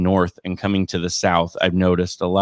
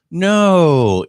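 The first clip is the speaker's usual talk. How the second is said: emphatic no; 7 semitones higher, 6 dB louder than usual. Acted. Mock-incredulous reply to Steve.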